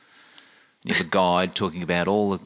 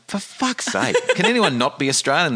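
much higher, loud exasperated complaint; really loud; 10 semitones higher than usual. Retold or imitated. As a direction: moan loudly so everyone hears. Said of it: "sort of really loud".

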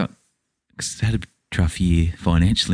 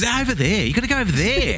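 high, snippy put-on voice; mocking the greeter; 13 semitones higher than usual. Mocking the greeter, high and snippy. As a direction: brush them off like a bored greeter. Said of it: "greeter girl goes".

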